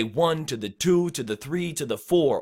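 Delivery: "one", "two", "three", "four" stressed, "to the" tucked lightly between; rhythmic on-beat count. Shown as a demonstration. Coach demonstrates staying in the pocket, numbers stressed on the beat. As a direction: bounce through "to the", landing on each number. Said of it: "So you can say".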